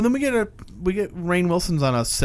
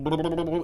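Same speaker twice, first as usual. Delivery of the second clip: burbled blah-blah noises; mock underwater babble; 3 semitones higher, 3 dB quieter than usual. Imitating Doctor Heller in the water, mock burbling. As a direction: babble like someone bobbing in water.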